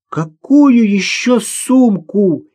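high, drawn-out distressed cry; near tears. A narrator's reading. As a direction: call out in distress, stretching the words. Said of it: "Ирина была готова расплакаться". (на грани слёз, протяжно)

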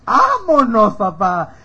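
high, loud mock cheer; sarcastic cheer. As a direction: cry it out as sarcastic applause. Reacción burlona a la excusa. (grito de ánimo sarcástico)

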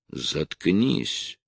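plain reading voice

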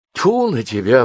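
a sharp indignant spit of disgust; flaring up indignantly. Acted. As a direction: bristle up and snap it. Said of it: "взвилась Валентина Семёновна".

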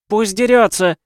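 loud, high outraged yell; indignant shout. Acted. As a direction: shout it from the crowd in protest. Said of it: "возмущённо крикнула женщина из зала".

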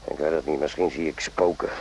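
low doubtful murmur. Acted. Doctor doubting, muttering.

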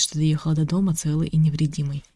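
ordinary delivery

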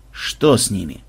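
low, wary question; suspicious. A narrator's reading. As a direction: ask with quiet suspicion. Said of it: "с подозрением косясь на Ксенобайта".

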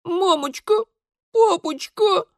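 put-on very high, pitiful little girl; plaintive child's babble. A narrator's reading. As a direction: whimper for mummy and daddy. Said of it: "жалобно-жалобно пролепетала толстушка Алевтина".

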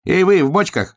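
high, loud hare's call; shouting. A narrator's reading. Hare hailing the two in barrels, shouting. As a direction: hail them in a high, loud voice. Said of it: "закричал он".

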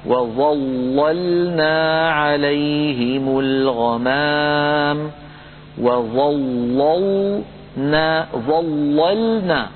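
slow chanted وظللنا with the first لام heavy; وظللنا drawn out, first لام full; Quranic recitation. Shown as a demonstration. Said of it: "مثال المفتوحة قوله تعالى".

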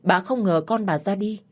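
ordinary delivery, nothing marked

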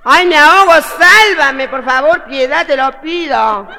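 high, loud, hammed-up begging; mock drowning plea. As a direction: wail for rescue, very loud at first. Drowning man begs to be saved.

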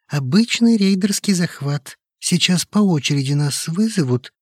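high, agitated, reasoning it out; worried man laying out a theory; anxious younger colleague. A narrator's reading. (встревоженный голос, высокий)

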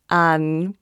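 ordinary talk

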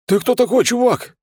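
high, loud voice of a stunned thug; amazed thug. A narrator's reading. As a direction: blurt it out in high-pitched amazement. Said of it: "изумлённо посмотрела на меня бандитская морда". (изумлённый бандит, высоким голосом)

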